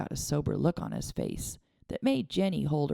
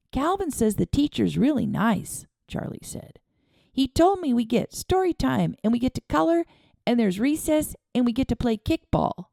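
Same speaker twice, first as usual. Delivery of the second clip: put-on excited little boy; 9 semitones higher than usual. A narrator's reading. Boy telling his dad about school, excited. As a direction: rattle off the fun parts of school.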